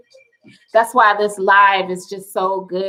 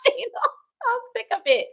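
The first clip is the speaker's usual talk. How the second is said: high and soft, still laughing and fed up; half-laughing, half-despairing complaint; hysterical; 12 semitones higher, 6 dB quieter than usual. Real, not acted. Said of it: "I'm feeling a bit hysterical".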